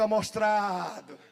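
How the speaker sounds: mocking. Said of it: "como diz lá em Pernambuco".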